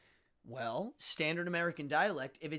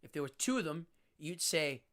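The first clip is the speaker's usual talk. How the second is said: ordinary delivery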